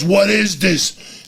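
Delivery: slow, high mock teacher demand; hammed-up overwhelmed teacher; shrill demand. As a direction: stretch it out, exasperated. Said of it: "She goes".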